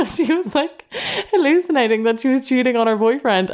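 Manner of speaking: high-pitched giggling through the words; laughing. Real, not acted. Telling listeners about a hallucination, laughing high. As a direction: laugh out the punchline to a friend. Laughing while retelling funny story.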